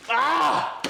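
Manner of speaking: sudden high yelp as the fin stabs; a quick real cry of surprise; startled yelp. Real, not acted. Grabbing the fish barehanded, a sharp startled yelp.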